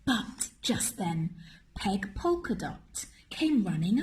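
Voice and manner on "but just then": ordinary delivery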